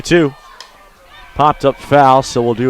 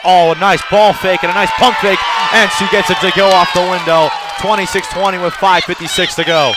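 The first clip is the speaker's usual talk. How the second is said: louder, higher call of a nice move; excited call; 5 semitones higher, 6 dB louder than usual. Real, not acted. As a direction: admire the pump fake out loud. Basketball play-by-play, nice move.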